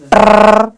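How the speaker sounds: "ट्रर" with a long rolled r; syllable "ट्रर" alone, r drawn out; sounded-out syllable. Shown as a demonstration. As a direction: trill the r at the end of "ट्रर". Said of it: "तो T E आर".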